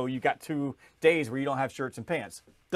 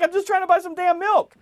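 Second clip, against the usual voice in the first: much higher and louder, fed up and exasperated; exasperated outburst; 8 dB louder than usual. Retold or imitated. Shopper stuck at checkout snaps at staring line, exasperated. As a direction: blurt it out like you've had enough. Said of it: "And I'm like".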